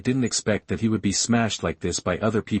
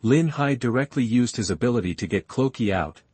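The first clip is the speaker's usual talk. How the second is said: ordinary delivery, nothing marked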